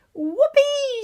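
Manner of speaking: high, loud whoop of joy; an old woman whooping as she shoots up; gleeful shout. Acted. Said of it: "she shouted".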